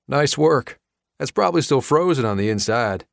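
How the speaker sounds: light twang, ribbing his friend; lightly voiced Chuck. A narrator's reading. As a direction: neighbor teasing his friend's burnt cooking, lightly twangy.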